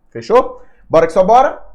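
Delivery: high, loud hype; rallying cheer. Real, not acted. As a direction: pump the class up loudly. Teacher rallying class at end. (professor animando a turma com empolgação)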